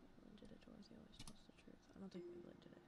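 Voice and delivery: unvoiced whisper to herself. Real, not acted. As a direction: whisper the clues, working it out. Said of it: "whispering while thinking".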